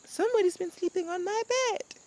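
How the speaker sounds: high mother bear voice; Mama Bear. Acted. Mama bear finds someone in her bed. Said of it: "Mama Bear goes".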